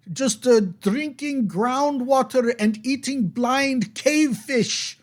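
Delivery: put-on high, slow, pleading young man; trapped young man, relieved and lonely. Young man stuck in the well complains to the adventurers, high and slow. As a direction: tell your sad story from the bottom of the well.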